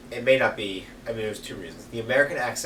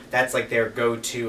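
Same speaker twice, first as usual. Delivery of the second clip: usual speaking voice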